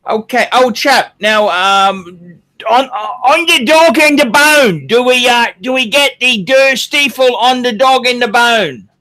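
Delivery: put-on British accent slipping into Cockney, loud; high, hammed-up Cockney-style British; Cockney-leaning British accent. Host keeping up the requested accent, sliding into Cockney. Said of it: "that's more Cockney".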